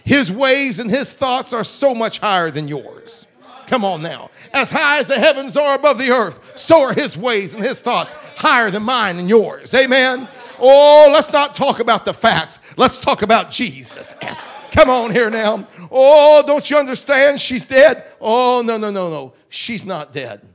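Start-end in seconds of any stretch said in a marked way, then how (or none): 0.0-11.1 loud, rising revival preaching; preaching at full force. Acted. 11.1-14.1 the same line louder, with a laugh in it; preacher hammering his point home; louder repeat. Acted. 14.7-19.3 loud, rising revival preaching; preaching at full force. Acted.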